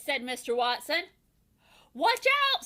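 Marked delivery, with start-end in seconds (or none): 1.9-2.6 high, louder alarm; warning call. A narrator's reading. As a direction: shout a quick warning. Warning shout.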